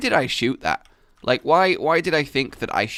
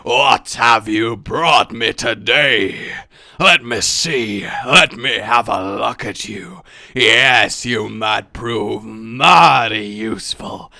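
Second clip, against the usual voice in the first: slow, low, menacing villain; deliberate, deep sinister drawl; deep threatening voice; 3 semitones lower, 4 dB louder, slower than usual. Shown as a demonstration. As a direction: loom over them in a deep villain voice. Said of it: "a really deep, threatening voice".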